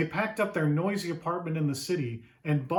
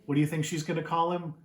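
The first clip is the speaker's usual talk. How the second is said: ordinary delivery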